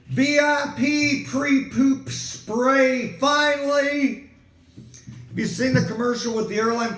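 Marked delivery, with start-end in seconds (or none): 0.1-4.3 drawn-out, grand product name; mock ad read, stretched out slowly; slow mock announcer. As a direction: announce it like a luxury brand.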